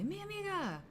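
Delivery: high, sung-out Mi amiga; sweet greeting. Retold or imitated. Greeting the cleaning lady friend, high and sweet. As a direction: greet her fondly, lilting. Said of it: "and I say".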